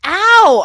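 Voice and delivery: high, loud sudden ow; mock yelp. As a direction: yelp like a burned kid. Acted kid's pain cry.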